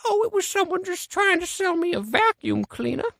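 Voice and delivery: high-pitched grandma voice; sweet high granny putting on an old voice. Acted. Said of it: "Gram Gram, who was that?".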